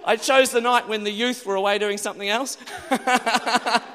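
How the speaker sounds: loud, laughing through the words; real laughing. Real, not acted. Preacher recalls trying to get Presbyterians dancing, laughing.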